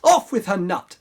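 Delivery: punchy loud command; loud order. Acted. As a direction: bark the order. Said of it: "The prince cried".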